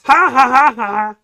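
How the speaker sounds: high puppet cackle; Peanut voice laugh. Pretend. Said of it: "but Peanuts voice".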